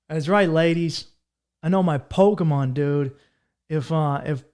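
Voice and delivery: ordinary delivery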